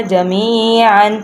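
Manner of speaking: slow "جمیعاً" ending in a sounded "an"; full ending, no stop. Shown as a demonstration. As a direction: stretch the ee of "جمیعاً" and finish on n. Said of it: "تو ہم نے اس طرح پڑھنا ہے". ("جمیعاً" پورا، آخر میں نون کے ساتھ)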